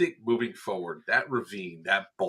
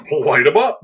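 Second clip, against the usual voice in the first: loud, eager call to open fire; gleeful shout; 5 semitones higher, 10 dB louder than usual. Retold or imitated. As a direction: shout it out like giving the go order. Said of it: "I was like".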